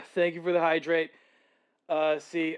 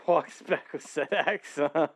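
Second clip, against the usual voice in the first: ordinary delivery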